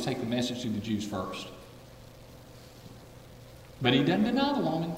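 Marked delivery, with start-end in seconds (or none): 0.0-1.5 low, soft command; quiet Father. Retold or imitated. The Father instructing Jesus, low and soft. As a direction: give the order softly, low. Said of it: "The Father says".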